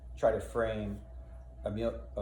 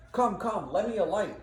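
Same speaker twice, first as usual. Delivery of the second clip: urgent call; 11 semitones higher, 5 dB louder than usual. Acted. Iago calling out urgently.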